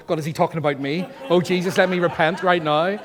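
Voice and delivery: mock guilty panic. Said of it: "they go".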